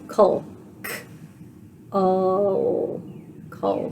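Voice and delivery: Cul said slowly, sound by sound, K then Ul. Shown as a demonstration. Teacher sounding out "Cul" for the class. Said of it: "let's sound out the first syllable".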